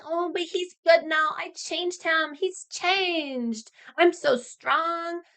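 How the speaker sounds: high, gushing princess voice; mock naive lovestruck. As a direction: gush it in hammed-up, high naivety. Mocks naive fans excusing the villain.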